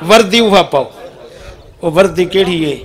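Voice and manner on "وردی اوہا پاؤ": loud, raised preacher's call; rally the seated listeners; tag calling out. Real, not acted. Preacher urging the seated crowd to join in, calling out.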